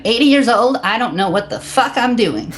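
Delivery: put-on high, bluntly honest old woman; imitating an old lady. Retelling a training session, a high mock old lady admitting she's lost. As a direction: play the eighty-year-old confessing cluelessness. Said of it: "and she says".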